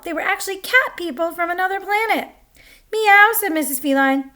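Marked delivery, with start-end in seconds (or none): 2.9-3.3 higher, louder cat meow. A narrator's reading. Cat people revealed.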